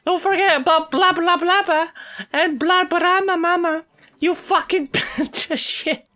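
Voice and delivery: high, loud mocking nonsense names; sarcastic rant. Collector mocking made-up names, high furious sarcasm.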